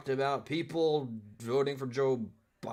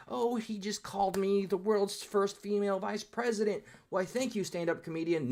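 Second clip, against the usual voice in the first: high, put-on voice of a gushing fan; mock gracious woman; 8 semitones higher than usual. Mocking the heckler as if she loved the insult, high and sugary. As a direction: play her as if flattered, sweetly fake. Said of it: "She was like".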